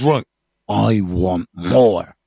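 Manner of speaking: slow, drawn-out greedy demand from the drug-hungry head; crazy head voice. Retold or imitated. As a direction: let the inner craving speak, slow and odd. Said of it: "crazy head goes".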